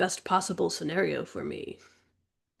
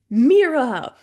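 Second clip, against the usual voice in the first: surprised call; 6 semitones higher, 7 dB louder than usual. A narrator's reading. Narrator calls out her sister.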